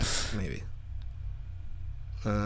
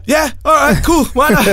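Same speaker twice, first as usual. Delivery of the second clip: eager yes; 8 semitones higher, 4 dB louder than usual. Retold or imitated. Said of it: "I'm like".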